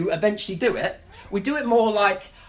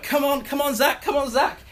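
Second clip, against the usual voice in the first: put-on high, raised coaxing call to the boy; urging Zach on; 9 semitones higher than usual. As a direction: chivvy Zach along, pitched up. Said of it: "And so I'm like".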